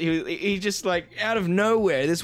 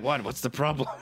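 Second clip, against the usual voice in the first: bad Irish accent with thirty as dirty; a clumsy stab at an Irish accent, dirty for thirty; poor Irish accent imitation; attempted Irish accent; 3 semitones lower than usual. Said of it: "I'm trying to say "thirty" as an Irish accent".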